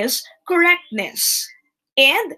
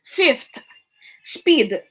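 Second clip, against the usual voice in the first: ordinary talk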